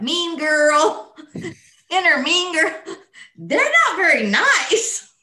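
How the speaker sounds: amused, laughing aside about the inner critic. Real, not acted. Laughing about inner mean girl.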